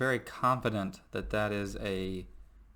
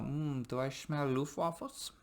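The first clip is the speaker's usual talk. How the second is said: high, soft mock German accent, sniffing the air; a hammed-up German accent, playing the smell line for laughs; German accent, a hint higher and softer; 5 semitones higher, 5 dB quieter than usual. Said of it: "that German accent".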